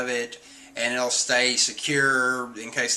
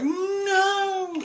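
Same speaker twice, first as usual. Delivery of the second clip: squeaky panicked cry; high scared no. Retold or imitated. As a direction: shriek it in cartoon fright. Usopp and Chopper react scared.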